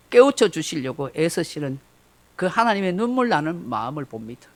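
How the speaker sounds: ordinary delivery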